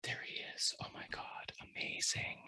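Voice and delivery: hushed, awed whisper; starstruck whisper. Retold or imitated. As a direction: whisper it as if your idol just walked in. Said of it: "this is Thomas".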